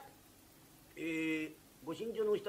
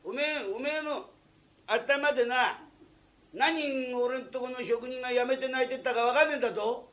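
loud, higher scolding; angry tirade; 6 semitones higher, 7 dB louder than usual. Acted. Master berates the barber for driving off workers, loud and angry. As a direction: lay into him hard.

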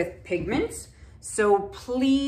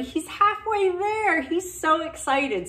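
excited; 8 semitones higher than usual. Real, not acted. Proud of son's progress.

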